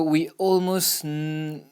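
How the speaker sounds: ordinary delivery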